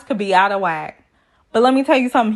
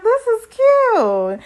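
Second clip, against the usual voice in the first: high, slow cooing aside; 12 semitones higher, much slower than usual. Real, not acted. Vlogger watching the couple's Star Wars fight, cooing high and slow. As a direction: coo over them like it's adorable.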